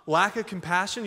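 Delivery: normal talking voice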